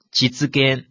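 実現 read as Japanese "jitsugen", the 実 as "jitsu"; 実現 said the Japanese way, "jitsu-gen". Shown as a demonstration. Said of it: "一般读作基兹".

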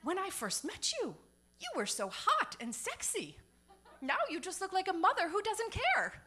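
high, quoted boss voice; imitating Henry. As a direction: ham up the rude coworker's remark. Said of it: "And he says".

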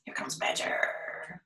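soft whispered announcement. Acted. Telling a child the badger is coming, whispered. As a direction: whisper it like a secret.

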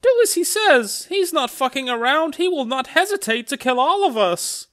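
very high put-on female voice; frightened-lady role. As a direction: urgently warn the others in falsetto. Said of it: "then some lady who I'm".

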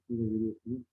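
ordinary delivery

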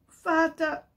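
high, loud, urgent appeal to a father; stammelnder Sohn. A narrator's reading. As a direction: call out to father as a remorseful son. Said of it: "stammelte der Sohn". (stammelnd, reumütig)

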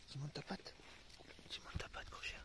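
soft whispered coaxing; whispering to the dog. Real, not acted. (chuchoter au chien pour le calmer)

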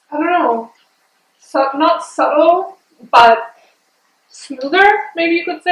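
talking around frozen tube; freezie at mouth. Real, not acted. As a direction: lips on icy pop. Seen 2: Speaker holds freezie tube against lips while talking throughout.

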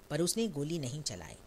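ordinary delivery

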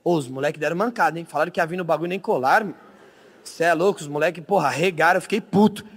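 ordinary talk, nothing marked

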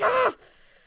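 sudden loud, high cry; mock outburst. Player showing how they'd react to falling, mock outburst. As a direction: blurt out a high, exaggerated noise.

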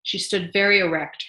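plain reading voice

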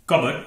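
कबर्ड with silent p; correct pronunciation. Shown as a demonstration. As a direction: say कबर्ड as CUB-urd. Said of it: "इसे बोलेंगे कबर्ड". (सही उच्चारण, कबर्ड)

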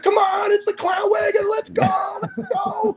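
very high, hollering crowd voice; mock gleeful shouting. Firemen piling into the back of the Beetle, mock gleeful shouting. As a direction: holler high, like rallying the whole crew.

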